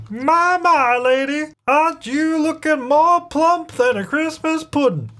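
high, hammed-up character voice, drawing out the words; nectar guy voice. Nectar guy teasing the pregnant lady, high hammed-up voice. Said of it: "I don't know what his voice was either".

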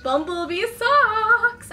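high, loud and slow; slow high gushing bumblebee socks; delighted. Real, not acted. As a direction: savor it slowly with delight. Unboxing delighted.

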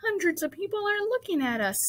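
high-pitched anxious voice; nervous whispered aside. A narrator's reading. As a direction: fret in a high, thin pitch. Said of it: "whispered Missus Zuckerman".

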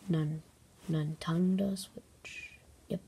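whisper. Acted. Whispered repeat of the product.

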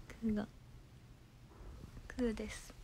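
hushed whisper. Real, not acted. Introducing her sleeping pet in a whisper. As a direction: whisper so the pet stays asleep.

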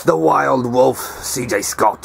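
hushed wildlife narrator voice; nature documentary. Pretend. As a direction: present him like a rare animal. Mock nature documentary intro.

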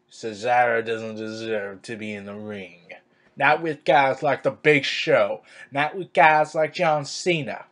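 mock Southern-accented Vince. Fan mimicking Vince dismissing Cesaro, Southern accent.

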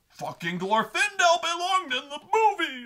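high, strained, sustained mock scream; hammed-up hysterical fury; frothing at the mouth. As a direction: imitate a fan screeching with rage. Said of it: "fucking frothing at the mouth".

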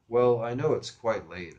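slow, loud, deliberate politeness; very mature voice. Acted. Teen apologizes to woman for calling late, mock-mature voice. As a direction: play a teen posing as a grown man. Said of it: "in this very mature voice and all".

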